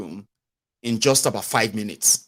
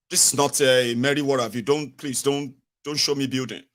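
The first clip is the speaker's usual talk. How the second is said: ordinary talk, nothing marked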